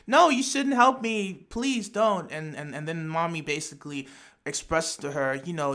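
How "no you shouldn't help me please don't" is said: higher, louder desperate plea, stopping short of screaming; raised-voice plea, easing off at the end; mock pleading scream. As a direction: act out a character crying out in protest. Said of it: "Kyoko screaming at Mami".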